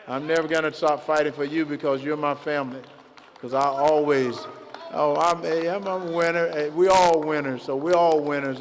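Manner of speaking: loud rallying crowd speech; rally the supporters, building loud; rousing speech. Acted.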